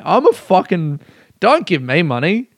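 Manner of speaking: usual speaking voice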